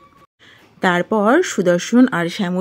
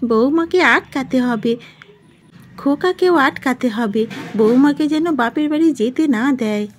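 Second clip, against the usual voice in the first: panicked mother-in-law; 6 semitones higher than usual. Acted. Mother-in-law fearing she will do all housework, panicked. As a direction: fret aloud in a raised, anxious pitch.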